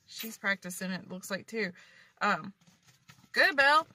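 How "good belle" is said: cheering. Real, not acted. Cheering on cousin at practice.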